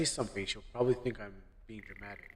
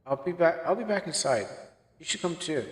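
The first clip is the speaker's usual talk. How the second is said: higher, lightly voiced girlfriend, casual; 6 semitones higher, 4 dB louder than usual. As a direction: play her upbeat and carefree, pitched up.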